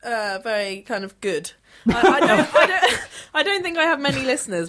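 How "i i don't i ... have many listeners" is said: higher, louder, stumbling through giggles; real embarrassed laughter. Real, not acted. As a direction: confess it while laughing. Guest laughs while admitting few listeners.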